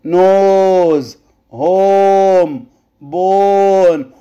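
slow "نوز، هوم، بون", each O drawn out; long vowel. Shown as a demonstration. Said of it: "بمد في الاو هنا". (مد الـ o في "نوز، هوم، بون")

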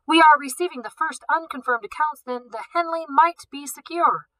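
high urgent breaking-news report; urgent news reporter. A narrator's reading.

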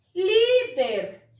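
"Líder" con LÍ alargada y fuerte; énfasis en la sílaba tónica. Shown as a demonstration. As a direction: marca bien la primera sílaba de "Líder". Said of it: "¿Dónde está la sílaba tónica?". (sílaba tónica marcada en "Líder")

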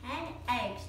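ordinary delivery

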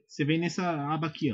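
ordinary talk, nothing marked

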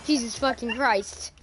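usual speaking voice

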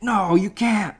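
stubborn voice. Retold or imitated. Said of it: "Rocky's like".

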